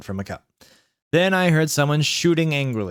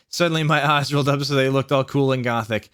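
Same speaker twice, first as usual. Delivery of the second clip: ordinary delivery, nothing marked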